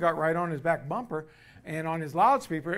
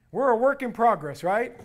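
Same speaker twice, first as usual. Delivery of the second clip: normal talking voice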